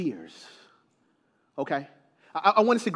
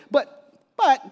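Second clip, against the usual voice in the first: ordinary delivery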